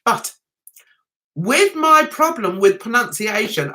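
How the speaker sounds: normal talking voice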